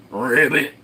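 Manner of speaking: "Ribbit" croaked in an odd put-on voice; frog croak. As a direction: play the frog for a second. Imitating the mountain chicken frog.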